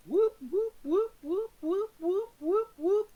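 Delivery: high, rising "whoop" siren imitation; mock alarm siren. Host sounding the mirror alert, mock siren. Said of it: "Doctor Who mirror alert".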